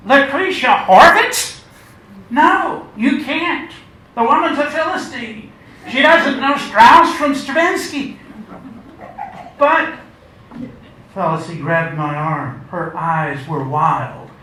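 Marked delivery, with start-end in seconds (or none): 0.1-9.9 very high, loud scandalized protest; scandalized, pleading, sneering at the rival; indignant protest. Acted. Hearing the ticket goes to Lucretia, a shrill protest.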